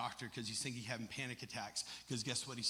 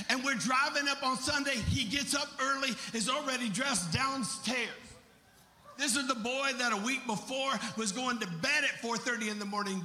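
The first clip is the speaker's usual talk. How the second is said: raised, ringing preacher storytelling; impassioned preaching; 12 semitones higher, 6 dB louder than usual. Acted. Preacher marvelling at his changed son, impassioned preaching. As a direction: carry the testimony to the back row.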